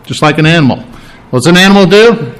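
usual speaking voice

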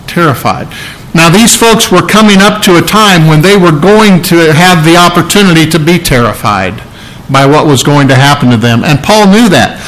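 ordinary talk, nothing marked

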